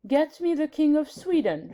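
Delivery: high, imperious Queen on the phone; a queen summoning a king by telephone; regal command. A narrator's reading. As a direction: order the operator like royalty. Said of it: "the Queen commanded".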